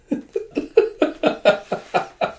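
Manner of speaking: high, rapid acted laugh; long mock laughing fit; retold laughing fit. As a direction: laugh it out as the amused character. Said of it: "And Jaeha's just laughing".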